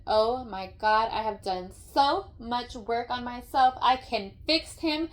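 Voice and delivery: loud, high, gushing mock testimony building to a shout; mock fix-him woman. Mocking a woman sure she can heal a broken man, loud and gushing. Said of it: "and said".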